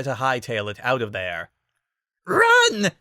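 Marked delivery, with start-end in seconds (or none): 2.3-2.9 very high, loud panicked scream; shrill scream. A narrator's reading. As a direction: shriek in panic. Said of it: "he screamed, not caring about how shrill his voice sounded".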